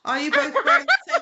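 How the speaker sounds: high, fast, laughing question. Real, not acted. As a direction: laugh it out in delight. Spots matching tops, laughing.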